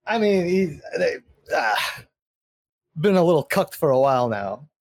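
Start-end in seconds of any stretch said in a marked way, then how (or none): none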